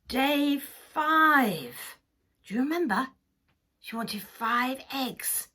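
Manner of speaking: usual speaking voice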